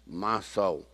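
low, quiet inward address; talking to oneself. Acted. David questioning his downcast soul, low self-talk. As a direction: speak low, as if to your own soul.

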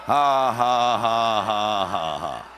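deliberate "ha ha ha", falling in pitch and fading; demonstrated defiant laugh. Shown as a demonstration. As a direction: put on a laugh in the devil's face. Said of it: "look the devil in the face and laugh".

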